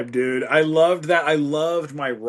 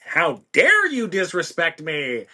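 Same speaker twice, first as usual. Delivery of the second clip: Gustavo; 3 semitones higher than usual. Acted. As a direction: act grandly affronted, stretching the words. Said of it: "record producer with a giant ego".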